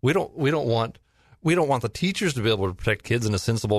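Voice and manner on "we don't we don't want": ordinary talk, nothing marked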